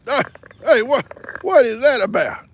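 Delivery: high, loud startled question; surprised. Real, not acted. Host startled by someone near the phone, high and loud. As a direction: react to a sudden interruption.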